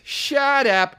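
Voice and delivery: very high theatrical retort; high, exaggerated mock dismissal; overdone actor's shut up. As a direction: mimic a hammy co-star. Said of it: "he goes like this".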